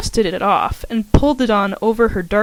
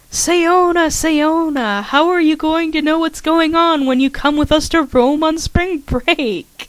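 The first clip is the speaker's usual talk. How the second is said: teasing scold; 7 semitones higher than usual. Acted. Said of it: "Karen shook her head".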